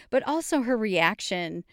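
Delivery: ordinary talk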